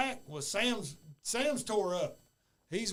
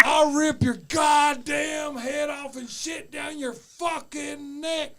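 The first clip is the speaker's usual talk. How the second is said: higher, slow, roaring threat, imitating Sam; furious threat; 6 semitones higher, 7 dB louder than usual. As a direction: shout the threat, dragging every word. Retold fight, Sam threatening.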